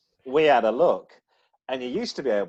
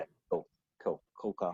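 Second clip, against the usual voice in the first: low, quiet admiring murmur; cool approval; 8 semitones lower, 7 dB quieter than usual. Retold or imitated. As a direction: say it low and soft, coolly impressed. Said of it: "I always look at a two thousand and two and go".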